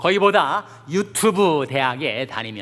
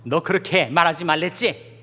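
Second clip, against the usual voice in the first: mildly acted scolding, a bit higher; 호통치는 엄마; 5 semitones higher, 5 dB louder than usual. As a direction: retell a mother telling off her child. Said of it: "호통을 치면서". (아이를 야단치는 엄마 흉내)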